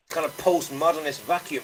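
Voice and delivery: ordinary delivery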